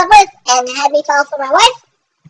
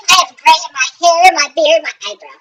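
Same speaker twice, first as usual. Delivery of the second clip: normal talking voice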